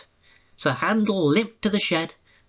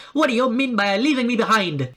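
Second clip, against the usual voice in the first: higher mild indignant demand; 5 semitones higher than usual. A narrator's reading. Refreshment lady scolding Peter Sam, indignant. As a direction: scold him sharply. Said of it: "she demanded".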